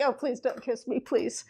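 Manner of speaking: soft, whiny mock child begging; softly voiced reluctant child; pleading toddler. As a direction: quietly beg like a kid dodging a kiss. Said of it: "but it's kinda like".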